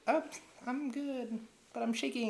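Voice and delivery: high falsetto reply; put-on high-pitched jitters; mock nervous woman. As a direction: imitate her in a high voice.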